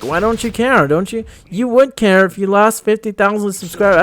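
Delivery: put-on high voice, parroting the critics; imitated questioner. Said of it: "a lot of people ask me".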